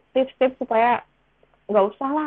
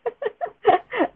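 high giggle; 13 semitones higher than usual. Real, not acted. Laughs after own quote.

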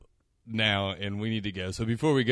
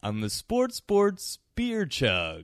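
slow, higher bro announcer voice; frat bro; 8 semitones higher, 5 dB louder, slower than usual. Acted. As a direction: play the proud frat boy, drawn out. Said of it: "frat boy".

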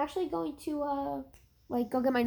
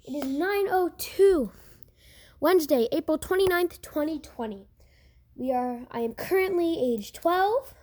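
podcast host; 3 semitones higher, 3 dB louder than usual. Real, not acted. Son introduces himself on podcast.

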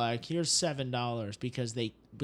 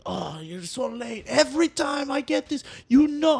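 higher and much louder, rising into a shout; angry owner; 9 semitones higher, 4 dB louder than usual. Retold or imitated. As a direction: scold the late delivery boy. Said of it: "he's pissed".

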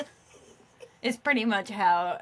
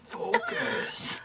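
mock demon voice; 4 dB quieter, much slower than usual. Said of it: "That was my demon teacher voice".

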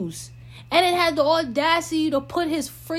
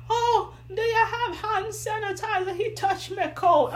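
higher voice imitating a distressed woman; lightly voiced frantic lady, pitched up; upset lady, imitated; 7 semitones higher than usual.

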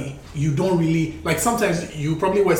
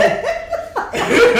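high shrieking laugh. Real, not acted. Laughs at show story.

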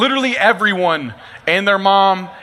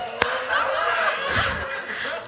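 high breathy laugh; 8 dB quieter than usual. Real, not acted. Laughing at own joke.

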